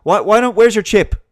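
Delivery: high, loud mocking shout; mock shrill demand. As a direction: squeal it like an outraged scold. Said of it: "And then it'll be".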